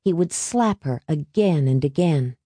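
ordinary delivery, nothing marked

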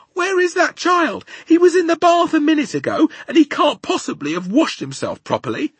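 high, loud mother's complaint; fussing high-voiced mum; exasperated mummy. A narrator's reading. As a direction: demand to know where the child went. Exasperated mummy hunts missing child.

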